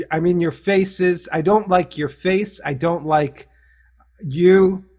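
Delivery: playful pretend anger; mock rage. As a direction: try to rage at a friend and fail. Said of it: "here comes the rage at Andrew".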